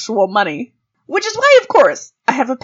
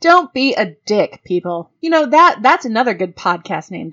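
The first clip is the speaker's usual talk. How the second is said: normal talking voice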